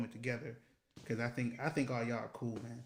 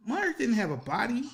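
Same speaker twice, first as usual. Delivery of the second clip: much higher and louder, pushing the punchline; emphatic repeat; 10 semitones higher, 6 dB louder than usual. Acted. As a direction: hit it again, way up and loud. Repeats the Marge line louder.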